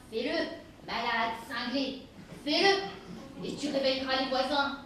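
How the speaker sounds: loud, daring him to break the door; mother shouting through the door at her son; defiant shout. Acted. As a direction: hurl the dare back at him, loud.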